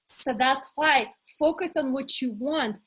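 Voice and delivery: normal talking voice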